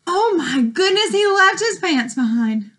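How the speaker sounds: shocked and amused; surprised gasp. Acted. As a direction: gasp then exclaim. Reacting to lost pants.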